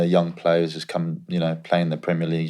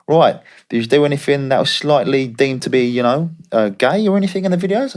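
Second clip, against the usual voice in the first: put-on higher, brisk Australian accent asking a blunt question; Australian agent voice; 6 semitones higher, 3 dB louder than usual. Australian agent grilling the player on the phone, higher Aussie accent. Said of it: "he's Australian".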